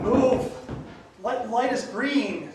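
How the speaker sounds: loud, high frustrated yell; shouted. Real, not acted. Driver in traffic shouting at the car ahead.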